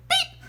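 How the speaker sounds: very high, sharp squeak; high squeaking chirp. A narrator's reading. Boy sneaking a bird alarm chirp in class, high squeak. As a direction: squeak like a blackbird. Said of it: "let out a high, squeaking blackbird".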